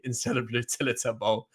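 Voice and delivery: ordinary talk, nothing marked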